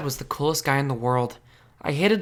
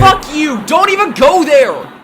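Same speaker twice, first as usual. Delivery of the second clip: loud, high furious shout; angry yell; 11 semitones higher, 11 dB louder, slower than usual. Acted. Teen yelling at best friend over rumor, furious shout. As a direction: shout him down hard.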